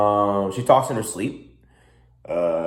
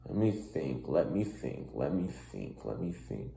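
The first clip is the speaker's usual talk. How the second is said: quieter and lower with each repeat; fading musing; 9 semitones lower, 14 dB quieter than usual. Real, not acted. Son pondering the question, trailing off softer. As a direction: drift off as you think. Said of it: "musing, repeated fading".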